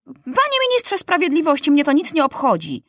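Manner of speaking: high-pitched impatient interruption; irritated young ruler; exasperated boy king. A narrator's reading. King interrupts legal recital.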